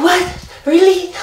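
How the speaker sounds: put-on excited kid. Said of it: "Ten-year-old Andrew would be like".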